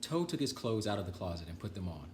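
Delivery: plain reading voice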